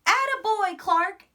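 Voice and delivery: high, loud cheer; teacher's delighted praise; proud praise. A narrator's reading. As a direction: cheer him on warmly.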